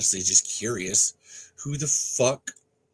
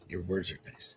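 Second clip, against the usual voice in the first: whisper; 4 semitones lower, 9 dB quieter than usual. Real, not acted. Reading card aside.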